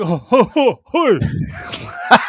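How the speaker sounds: high, loud goofy mock greeting of his co-host; mocking imitation. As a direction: ham it up like a clueless guy saying hi. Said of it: "You just sitting there like".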